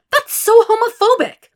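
very high, very loud outburst; furious shout. A narrator's reading. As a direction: scream it at her mother. Climax of phone fight.

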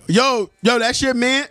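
calling out. Acted. Acted stranger to a man out walking, calling out. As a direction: act a nosy stranger hailing someone.

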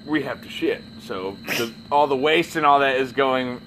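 usual speaking voice

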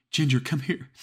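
high, loud frantic call; calling the dog. Acted. Fleeing caller calling her dog Ginger, frantic shout. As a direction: yell for the dog while running.

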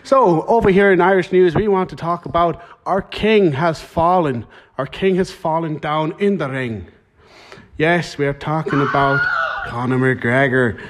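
put-on Irish newsreader accent; mock Irish bulletin voice; Irish news presenter. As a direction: deliver the headline as a hammed-up Irish anchor. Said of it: "we'll go over to Irish news".

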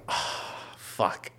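breathy, voiceless "oh no" moment; retold dread. Seeing the hole he put in the wall, breathy retold dread. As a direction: let it out on a hushed breath.

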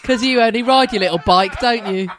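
teasing mock voice.

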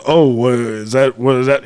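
mock the guy's sleazy hint; suggestive innuendo. Retelling the driver's innuendo, slow leering mock. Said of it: "trying to make it into a sexual innuendo".

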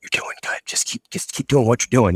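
hushed whisper to a neighbor; whispered reassurance. Retold or imitated. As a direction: imitate a classmate secretly whispering encouragement. Classmate secretly reassures him during scolding.

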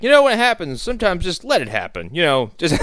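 mock advice. Teacher's defeatist advice mocked.

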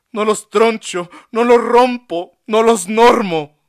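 high, loud, passionate defiant monologue; impassioned speech. A narrator's reading. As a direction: plead your dignity loudly to the doctor. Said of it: "monologó con honroso modo".

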